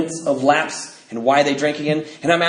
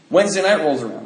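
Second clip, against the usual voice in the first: ordinary delivery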